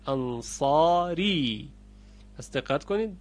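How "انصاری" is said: انصاری slowly with open a; slow انصاری, no imala; open vowel. Shown as a demonstration. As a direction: draw out انصاری with wide a. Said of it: "غلطه".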